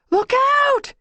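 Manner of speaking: high, loud warning shriek. A narrator's reading. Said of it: "she shrieked".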